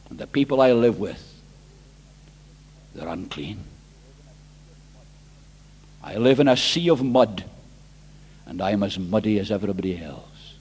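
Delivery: very low, sunken voice; grave confession. Acted. Preacher voicing Isaiah among unclean people, low grave confession. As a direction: confess it heavily from the bottom of the range.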